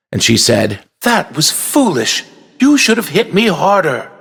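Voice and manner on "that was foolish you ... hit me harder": high, mildly scolding little voice; angry princess. A narrator's reading. Princess tells off toy knight, angry. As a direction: scold with a touch of temper. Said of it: "glaring angrily at the knight".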